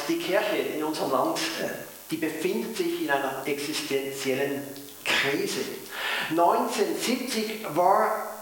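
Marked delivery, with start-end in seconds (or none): 0.1-5.6 switched to Swiss-flavoured Hochdeutsch; standard High German. Real, not acted. Pastor repeats for viewers in Japan, in standard German. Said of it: "dann rede ich in, in Hochdeutsch".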